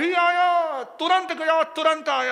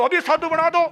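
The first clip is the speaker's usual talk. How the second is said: insistent demand; 6 semitones higher, 6 dB louder than usual. Retold or imitated. Man insisting on initiation.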